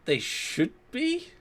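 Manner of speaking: normal talking voice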